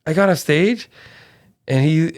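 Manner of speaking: normal talking voice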